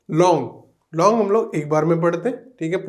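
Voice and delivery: normal talking voice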